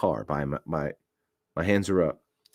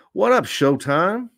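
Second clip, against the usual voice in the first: shout-out; 11 semitones higher, 5 dB louder, slower than usual. Real, not acted. Sudden shout-out, different voice.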